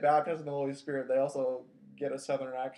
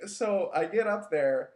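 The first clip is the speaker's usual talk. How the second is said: usual speaking voice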